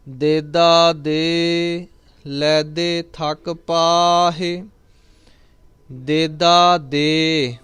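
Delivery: drawn-out, chanted reading of the line; slow devotional chant; slow scripture recitation. Acted. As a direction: recite it slowly and reverently. Recites gurbani slowly.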